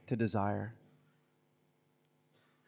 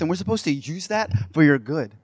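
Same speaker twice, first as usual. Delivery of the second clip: ordinary talk, nothing marked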